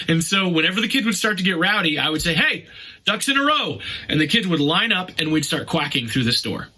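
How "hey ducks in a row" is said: calling out. Retold or imitated. Calling rowdy kids to order.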